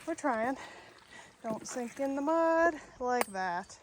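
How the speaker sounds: ordinary delivery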